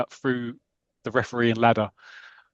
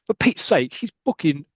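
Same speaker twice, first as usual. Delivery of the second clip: exasperated outburst; 7 semitones higher than usual. Retold or imitated. Arthur Seeley protesting the early booking, higher exasperated outburst. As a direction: blurt it out in disbelief. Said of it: "He goes".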